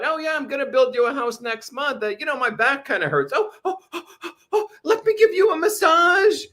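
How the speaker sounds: hammed-up sweet talk; mock wheedling. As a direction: parody of a scheming partner. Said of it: "mock guy and girl".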